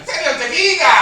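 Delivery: very high, loud mock-shrill reply; wife's reply. Wife calls down from the third floor, high loud reply. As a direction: shout back like the wife upstairs.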